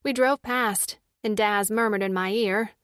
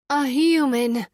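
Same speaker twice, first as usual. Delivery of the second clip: louder, lifted exclamation; excited cry; 3 semitones higher, 5 dB louder, slower than usual. A narrator's reading. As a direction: cry out in delighted surprise. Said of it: "she cried".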